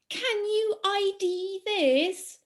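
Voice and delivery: high, very drawn-out theatrical challenge; dramatic riddle voice. Acted. Quizmaster opening a new plant riddle, dramatic put-on voice. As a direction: stretch every word, pitched high. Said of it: "do it in that kind of voice".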